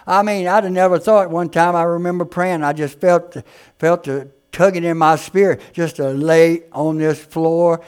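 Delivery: ordinary talk, nothing marked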